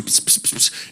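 whispered psst web sounds; web-shooting sound. Retold or imitated. Father imitating his son attacking his sister, whispered psst. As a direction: hiss softly like shooting webs. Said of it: "he starts going".

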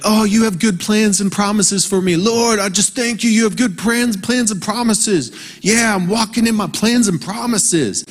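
high, sing-song mock prayer; mock gushing prayer. Preacher mocking a naive believer, high gushing prayer. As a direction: gush thanks to God like a naive believer.

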